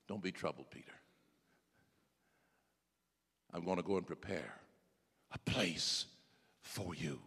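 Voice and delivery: soft, low, intimate words; tender whisper. Acted. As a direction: murmur comfort eye to eye.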